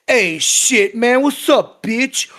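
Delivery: lightly hammed street-talk voice. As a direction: parody the cliché, not too hard. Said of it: "someone from the Bronx".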